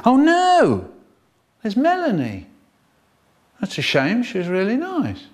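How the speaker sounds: high hammed-up mock regret; mock sorrow. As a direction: pretend to mourn a classmate. Mock mourning fallen classmates.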